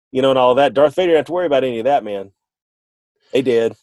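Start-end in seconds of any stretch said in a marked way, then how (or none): none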